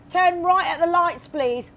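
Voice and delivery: high, slow Cockney-style London accent; local London accent. Shown as a demonstration. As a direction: put on broad London vowels for the cabbie. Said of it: "I tend to adapt my accent kung nasaan ako". (makapal na puntong London)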